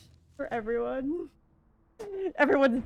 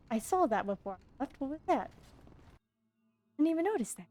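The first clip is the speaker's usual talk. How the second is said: normal talking voice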